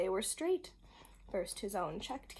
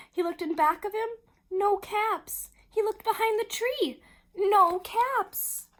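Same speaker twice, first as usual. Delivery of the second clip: higher, louder, urgent narration; alarmed storyteller, pitched up high; mounting alarm; 9 semitones higher, 7 dB louder than usual. A narrator's reading. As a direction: build the frantic search for the caps. Mounting alarm searching for caps.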